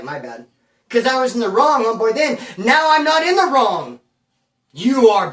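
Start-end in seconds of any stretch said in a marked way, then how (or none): 0.0-0.5 meek apology. Retold or imitated. Apologizing to bully. 2.6-5.3 defiant declaration. Real, not acted. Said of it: "I'm gonna handle it full blast".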